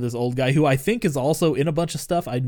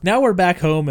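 usual speaking voice

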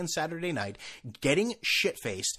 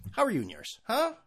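usual speaking voice

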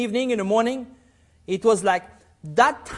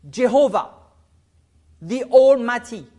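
usual speaking voice

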